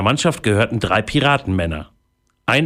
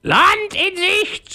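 high, drawn-out parrot screech; Paparazzi, crying out; slower than usual. Acted. Parrot on the mast spotting an island, screeching. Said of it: "schrie der Papagei".

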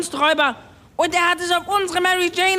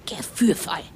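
mocking whisper, much lower; scornful whisper; 8 semitones lower, 4 dB quieter than usual. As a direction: spit the word out under his breath. Klaus mocks the word. (höhnisch geflüstert)

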